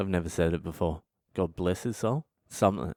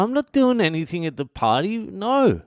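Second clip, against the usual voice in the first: high indignant refusal; 11 semitones higher, 4 dB louder than usual. Retold or imitated. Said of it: "I'm like".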